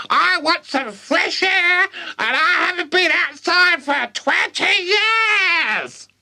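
very high, slow screech; shouting crone. Acted. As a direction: dismiss it with a screech. Grandma shouting through roof.

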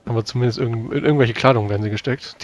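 ordinary talk

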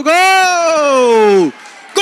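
long stretched goal shout; explosive goal commentary. Acted. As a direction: belt out the goal. Goal scored.

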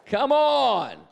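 high, loud rally; shouted come on; loud rallying call. Real, not acted. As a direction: fire up the congregation. Preacher rallying crowd.

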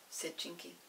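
usual speaking voice

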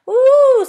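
long, high, loud "ooh" of delight; joyful squeal. A narrator's reading. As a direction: squeal with joy like a thrilled child. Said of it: "going red with joy".

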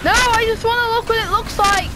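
high-pitched, exclaimed complaint; whining protest. Real, not acted. Player wants to look, high-pitched protest.

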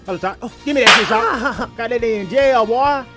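usual speaking voice

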